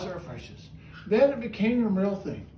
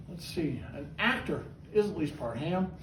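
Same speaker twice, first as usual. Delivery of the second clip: normal talking voice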